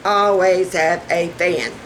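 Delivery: "Always have a fan" drawn out slowly; measured, deliberate emphasis; slow emphatic repeat. Real, not acted. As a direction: spell out the advice slowly, word by word.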